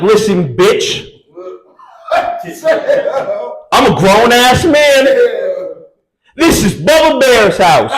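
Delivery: hammed-up slow authoritative yell; twice the authority. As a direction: shout it down, puffing himself up. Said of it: "come in with twice the authority".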